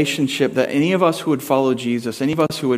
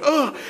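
mock groan; 11 semitones higher than usual. Daughter soaked by water.

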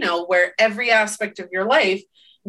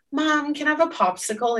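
put-on higher, childlike plea; asking kid; 7 semitones higher than usual. Child asking mom for a popsicle, light kid voice. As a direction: ask sweetly like a little kid. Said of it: "a kid comes up to you and says".

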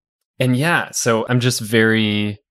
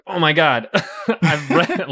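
excited; 8 semitones higher than usual. Retold or imitated. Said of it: "I was like".

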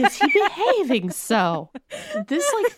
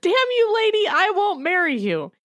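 mock curse; 8 semitones higher than usual. Hero's line read.

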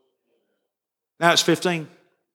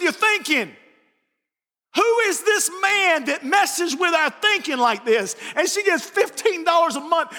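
raised, ringing pulpit voice; full-force preaching; 9 semitones higher than usual. Acted. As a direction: preach it out to the congregation. Preacher builds sermon climax.

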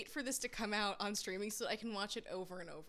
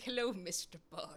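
mock Bond villain; 3 semitones lower, 3 dB quieter than usual. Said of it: "he's just doing".

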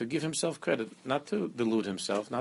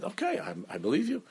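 high-pitched mock agreement; retold concession; 9 semitones higher than usual. Retold reply to the screamer after davening, high-pitched concession. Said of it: "I said".

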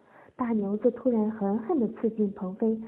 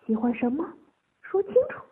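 insistent questioning, rising higher; pressing for an answer; 5 semitones higher, 4 dB louder, faster than usual. A narrator's reading. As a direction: press harder on the demand. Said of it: "继续追问".

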